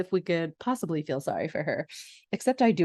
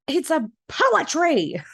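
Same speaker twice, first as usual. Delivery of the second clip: high, slow, put-on dim stepsister; silly stepsister voice; 8 semitones higher, 4 dB louder, slower than usual. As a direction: drag it out high like a clueless stepsister. Minerva introducing her poem.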